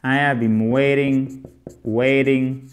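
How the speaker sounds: "waiting" with its t said almost like a d; "waiting" said as "wai-ding"; flapped t. Shown as a demonstration. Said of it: "le te se prononce presque comme un de".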